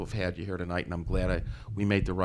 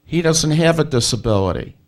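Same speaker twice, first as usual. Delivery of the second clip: to someone hard of hearing; 7 semitones higher, 11 dB louder than usual. Real, not acted. Host correcting faint caller loudly.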